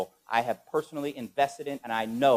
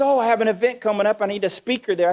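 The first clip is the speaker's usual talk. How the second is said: eager woman; 7 semitones higher than usual. Retold or imitated. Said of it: "She says".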